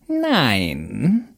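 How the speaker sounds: a long, swooping refusal, only mildly roared; Baba Yaga's roar. A narrator's reading. Baba Yaga refuses the mother, mild roar. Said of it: "brüllte die Baba Yaga".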